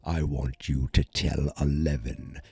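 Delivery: low, slow villain voice; Vecna. Retold or imitated. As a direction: menace it out, deep and deliberate. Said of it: "and he says".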